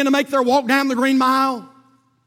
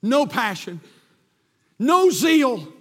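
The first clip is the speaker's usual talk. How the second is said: ordinary talk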